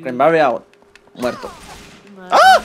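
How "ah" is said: short, very high yelp; sudden loud shriek of alarm; startled scream. Real, not acted. Streamer caught out in the match, startled scream.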